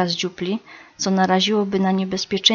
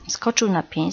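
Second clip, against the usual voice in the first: ordinary delivery, nothing marked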